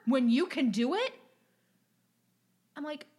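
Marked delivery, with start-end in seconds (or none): 0.1-1.1 louder, higher dismissive rant ending in blah blah; mock angry customer. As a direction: brush her off loudly like an entitled customer. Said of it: "he was like".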